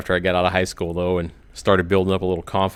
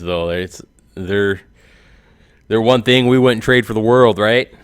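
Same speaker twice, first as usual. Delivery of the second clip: ordinary delivery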